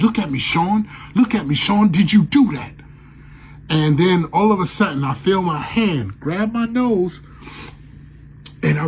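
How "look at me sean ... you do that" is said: high loud pleading demand; demanding the ghost look at him, loud and high; desperate shout. Retold or imitated. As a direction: yell it, begging him to face you.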